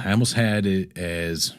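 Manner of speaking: ordinary delivery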